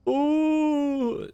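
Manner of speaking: high drawn-out mock wail; fake crying. Shown as a demonstration. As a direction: fake a sob on one sound. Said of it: "you can't just go".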